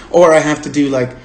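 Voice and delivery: ordinary delivery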